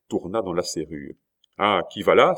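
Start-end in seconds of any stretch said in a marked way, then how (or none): 1.6-2.3 sharp, louder challenge at the door; raised-voice challenge; cried out. A narrator's reading. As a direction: call out who goes there, startled into volume. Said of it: "s'écria le duc".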